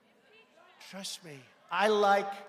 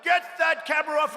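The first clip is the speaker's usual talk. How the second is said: raised, loud impatient command; mock screamed order; 6 semitones higher, 6 dB louder than usual. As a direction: imitate someone yelling at a camera operator. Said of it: "They're screamed at".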